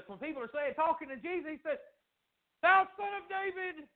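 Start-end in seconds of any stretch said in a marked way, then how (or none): 2.6-3.9 high, stretched-out holler; imitated high calling out. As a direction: holler it high like a beggar calling after someone. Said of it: "He just keeps hollering it".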